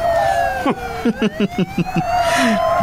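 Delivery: quiet gleeful cackle after the chase; gleeful laugh. Acted. Getaway gang laughing. (nagragsak a katawa)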